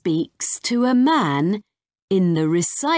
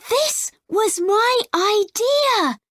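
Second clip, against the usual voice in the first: put-on much higher, pleased little girl; proud girl voice; 14 semitones higher than usual. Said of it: "says Rosie".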